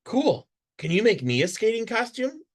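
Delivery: high, quick and eager; excited ask. A narrator's reading. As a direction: bubble over with the idea. Excited girl asking friend.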